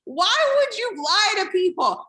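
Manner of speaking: high, disbelieving what are you talking about; incredulous. Real, not acted. Disbelief at leaders who hide the work, pitched high.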